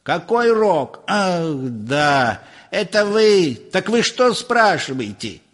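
high-pitched impatient questioning; exasperated professor. A narrator's reading. Professor recalls who is calling, high and impatient. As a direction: question him impatiently, voice lifted.

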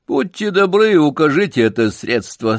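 высоко, иронично вежливо; старый Пудель подтрунивает над Джеком; с иронией. Acted. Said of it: "сказал с иронией старый Пудель". (иронично вежливо)

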